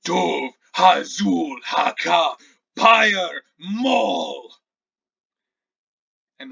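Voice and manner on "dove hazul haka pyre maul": slow, loud incantation that ends in a shout; booming spirit voice. Acted. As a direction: chant each word slowly, rising to a roar on Pyre Maul. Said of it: "you hear the voice again".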